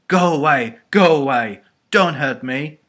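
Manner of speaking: loud acted scream, repeated in panic. A narrator's reading. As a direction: scream like a terrified child. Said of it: "it screamed".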